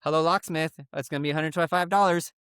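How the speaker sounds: fast, rattled-off phone greeting; quick lazy phone operator; rushed phone greeting. Retold or imitated. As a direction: blurt the whole pitch in one breath. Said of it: "I'd answer like this".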